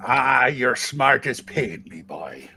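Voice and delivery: gruff pirate. Acted. Pirate voice after beard.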